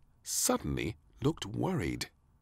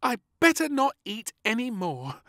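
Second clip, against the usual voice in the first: put-on high little girl voice; a small girl deciding firmly; little princess voice; 5 dB louder than usual. Princess deciding about beans, little girl voice.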